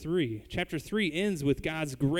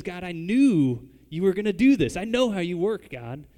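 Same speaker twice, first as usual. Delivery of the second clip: louder accusing outburst at God; angry Jonah; 3 semitones higher, 7 dB louder than usual. Retold or imitated. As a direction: accuse God, you saw this coming. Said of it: "So he's angry".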